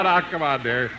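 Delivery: ordinary talk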